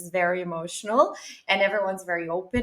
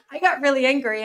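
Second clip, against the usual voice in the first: laughing while admitting it, a bit louder; real giggle breaking into the confession; 7 semitones higher, 3 dB louder than usual. Real, not acted. Recalling the hotel fight to a friend, laughing.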